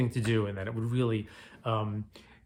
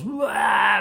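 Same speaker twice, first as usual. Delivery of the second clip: imitating an orc's loud, higher war shriek; orc scream; 7 semitones higher, 5 dB louder than usual. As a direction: belt out a mock monster yell. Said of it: "it pulls the sword into him and goes".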